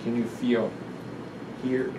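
plain reading voice

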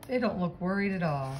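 ordinary delivery

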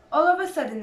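plain reading voice

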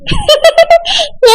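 a short, high burst of laughter at a friend's tears; laughing at a crying friend; amused laugh. Real, not acted. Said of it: "உனக்கு காமெடியா இருக்கோ".

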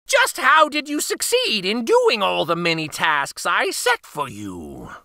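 put-on high, probing old witch; suspicious crone demanding answers. Baba Yaga questions the girl.

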